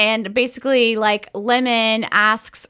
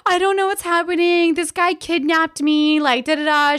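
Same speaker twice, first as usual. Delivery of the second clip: higher-pitched, put-on tearful whine; mock crying act; 6 semitones higher than usual. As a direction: imitate a girl faking tears to look innocent. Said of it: "she's, like, crying".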